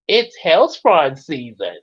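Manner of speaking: slow, deliberate announcement of hellspawn season; measured cartoon-style assertion; firm correction. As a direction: correct them with drawn-out certainty.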